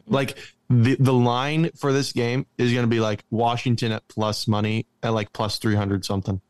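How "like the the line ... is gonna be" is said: usual speaking voice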